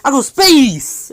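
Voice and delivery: high, loud, stretched-out gloating; acted excited shouting. Freed Kurama crows to Naruto about space, hammed-up excited shout.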